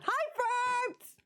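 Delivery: squeaky high-pitched hello to chat; sudden tiny cartoon voice; high cutesy greeting. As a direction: chirp it like a cartoon.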